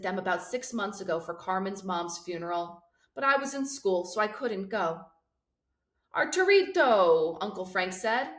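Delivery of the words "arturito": fond greeting. A narrator's reading. Uncle calling out fondly.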